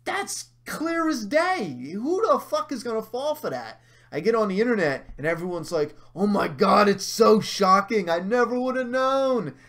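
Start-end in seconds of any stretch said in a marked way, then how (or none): 0.1-3.8 high, drawn-out exasperated outburst; indignant, pitched-up rant; pissed off. Retold or imitated. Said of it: "I was so pissed". 6.1-9.5 loud, high gushing fan voice; hammed-up shocked gushing, pitched up; mock amazement. Mocking gushing internet fans.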